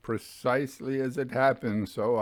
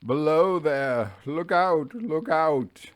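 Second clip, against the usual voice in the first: higher, louder urgent call; a hint of a ghostly agonized cry; 5 semitones higher, 4 dB louder, slower than usual. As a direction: call down in anguish. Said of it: "calls to me ... in an agonized manner".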